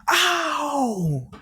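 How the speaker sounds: high, loud drawn-out ow, imitated; acted-out cry of pain; pained yelp. Group stung by teleporting.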